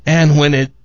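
ordinary delivery

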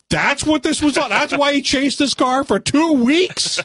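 high-pitched mock outrage; exaggerated indignant question; incredulous. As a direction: pitch it way up, slowing in disbelief. Predicted outraged reaction to ending.

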